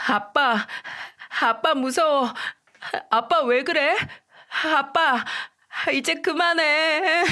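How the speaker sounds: put-on high, tearful little boy begging; crying child. A narrator's reading. Son tugging his father's collar, crying and begging him to stop. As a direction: beg like a scared child in tears. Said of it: "첫째는 울면서 남편에게 더 이상 그만하라며".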